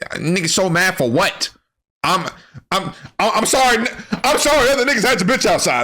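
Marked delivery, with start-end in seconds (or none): 2.0-4.7 fake-sorry voice getting higher; mock flustered apology. 4.7-5.8 fast high jab. Real, not acted. Fast insult.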